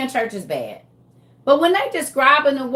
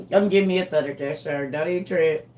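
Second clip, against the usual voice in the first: ordinary talk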